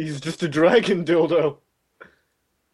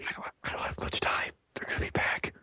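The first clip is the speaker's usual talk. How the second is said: hushed, voiceless captive whisper; urgent whisper; 13 dB quieter than usual. Pretend. Pretend captive secretly warning his friends, whispering. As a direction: whisper as if captors might overhear.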